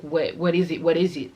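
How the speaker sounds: a friend's loud worried asking; alarmed friend. Retold or imitated. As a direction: press him for an answer, worried. Friend alarmed asking what happened.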